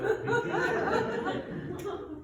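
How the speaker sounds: mocking laugh. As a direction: cackle at his fate.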